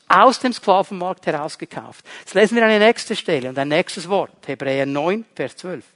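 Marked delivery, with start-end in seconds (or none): none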